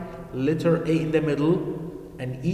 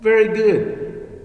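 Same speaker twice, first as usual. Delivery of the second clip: higher, louder, slow very good; praise; 7 semitones higher, 5 dB louder, slower than usual. Real, not acted. Teacher praising pupils, excited. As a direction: cheer the class on. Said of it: "correct answer".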